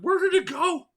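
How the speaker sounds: sudden high loud cry; panicked shout at the lost squid; alarmed. Real, not acted.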